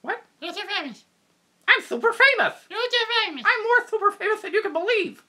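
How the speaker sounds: high, loud, boastful puppet dog; bragging puppet. Acted. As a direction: brag about being famous as a puppet.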